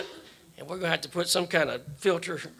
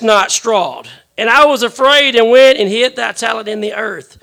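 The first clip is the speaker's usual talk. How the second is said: booming biblical narration; 4 semitones higher, 8 dB louder than usual. Acted. Reading resumes after a slip.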